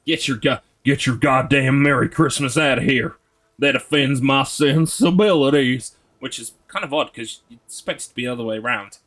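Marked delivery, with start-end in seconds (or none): 0.1-6.0 put-on loud, affronted southern American accent. Streamer ordering Merry Christmas out, in a hammed-up southern accent. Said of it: "that's some good southern accent".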